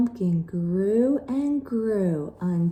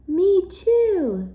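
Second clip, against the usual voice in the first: high, loud screech; 10 semitones higher, 7 dB louder than usual. Acted. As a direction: screech it shrilly back. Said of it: "screeched Wilda".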